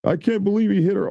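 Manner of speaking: high, mock-shocked gasp at the news; mocking outrage. Mocking viewers who gasp that he hit her, in a high shocked voice. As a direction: parody the people clutching their pearls. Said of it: "that's why I say it's not all of this".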